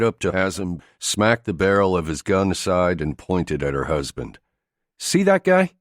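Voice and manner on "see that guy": higher, louder woman's voice; female character voice. A narrator's reading. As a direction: challenge him in her voice. Jamie confronts Haslam, voiced female.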